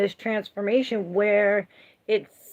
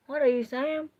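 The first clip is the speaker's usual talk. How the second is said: higher, incredulous question; amused disbelief; 6 semitones higher than usual. Real, not acted. Asking chat what they mean, amused. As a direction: toss it back, amused.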